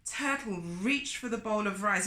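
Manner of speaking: plain reading voice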